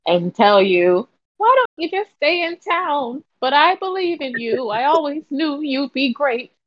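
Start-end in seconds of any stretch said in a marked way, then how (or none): 1.4-6.5 higher, sweet motherly aunt voice; a proud auntie cheering you on; doting auntie. Acted. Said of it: "I'm going to be your auntie slash mom".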